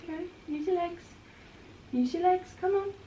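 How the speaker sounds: high, soft coaxing voice; coaxing the dog. Real, not acted. Owner urging her dog to walk, coaxing. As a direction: coax him to use his legs.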